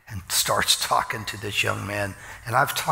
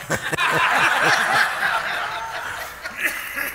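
real laughter. Real, not acted. Laughing at story.

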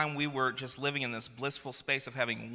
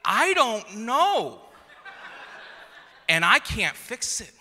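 high-pitched imitated toddler protest; mock little-kid whine, growing louder; three-year-old son; 8 semitones higher, 3 dB louder, slower than usual. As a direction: plead like an overwhelmed small child. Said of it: "And he's like".

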